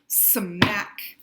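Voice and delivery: usual speaking voice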